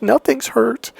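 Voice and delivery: high mock-weepy protest; put-on tearful denial; imitated tearful girlfriend. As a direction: whine it as a crying girlfriend. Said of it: "she's crying and crying".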